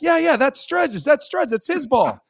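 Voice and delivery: high-pitched mock eager voice; squeaky tattletale teammate; imitating Tyson Nash.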